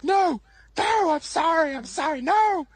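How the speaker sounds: very high, loud begging; hammed-up cries for mercy; mock panicked pleading. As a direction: imitate him frantically surrendering. Retold panicked surrender, hands up.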